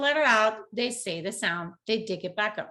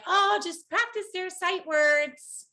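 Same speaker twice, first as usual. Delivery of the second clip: high, slow, airy imitation of easy advice; a touch of mocking cheer; mock breezy advice; 9 semitones higher, slower than usual. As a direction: sing it out like everyone's cheerful tip. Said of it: "everybody's like".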